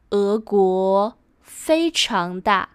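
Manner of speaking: slow, phrase by phrase; spaced-out teaching pace; slow model sentence. Shown as a demonstration. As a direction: model it slowly for learners.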